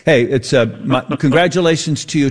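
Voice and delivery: usual speaking voice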